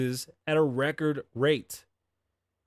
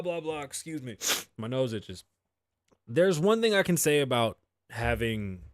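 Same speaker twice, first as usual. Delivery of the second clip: ordinary delivery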